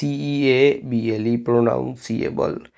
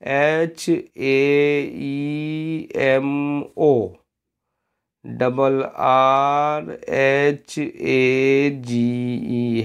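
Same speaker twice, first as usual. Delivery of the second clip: slow spelling out; much slower than usual. Shown as a demonstration. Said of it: "और इसकी भी दो spelling".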